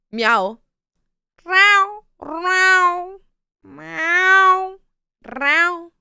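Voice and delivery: high loud meowing; yowling house cat. Acted. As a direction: meow like a cat. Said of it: "Yeah, cat sound".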